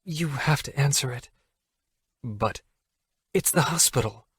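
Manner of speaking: Allie urging. Acted. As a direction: Allie tells Jack he must answer, softly.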